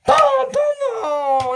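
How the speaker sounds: loud high imitated explosions; shouted booms. Fireworks going off, shouted high.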